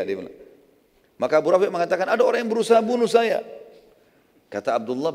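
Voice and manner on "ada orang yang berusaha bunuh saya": acted high, loud plea; alarmed victim. Wounded Abu Rafi' answering his supposed helper, acted alarm. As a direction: play a wounded man raising the alarm.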